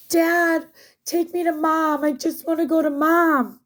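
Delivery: put-on high whiny kid asking for mom; scared little daughter. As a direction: beg like a frightened little girl. Voicing scared young daughter.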